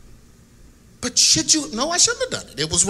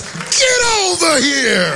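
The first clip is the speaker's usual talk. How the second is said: slow, gravelly Scorpion growl; 5 semitones higher, much slower than usual. Acted. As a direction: drag it out like Scorpion from Mortal Kombat. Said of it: "in my scorpion voice".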